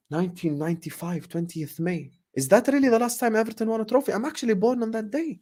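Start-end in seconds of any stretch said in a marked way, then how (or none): 2.3-5.4 disbelief. Real, not acted. Learns he was born that day.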